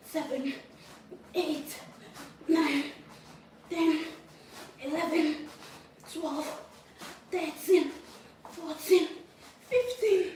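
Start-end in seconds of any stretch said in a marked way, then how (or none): 1.3-10.3 loud, rising count, fifteen pitched higher; straining count. Real, not acted. Counting her reps aloud to viewers, straining louder. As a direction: push the numbers out harder rep by rep.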